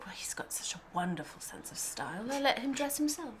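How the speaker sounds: a light attempt at a British accent, half laughing; lightly imitating a British costume designer. Director imitating the costume designer praising the actor, mild British accent. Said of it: "I can't really do her accent".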